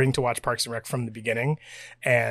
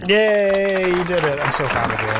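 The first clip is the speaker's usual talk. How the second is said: gushing praise; 5 semitones higher, 4 dB louder than usual. Acted. Co-host applauding her partner for finishing the bit, gushing. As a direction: cheer big, then ease down.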